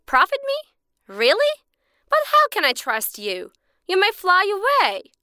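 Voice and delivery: high, incredulous questions; can't believe the offer; doubtful surprise. Acted. Suspicious man questions the bird, high and doubtful.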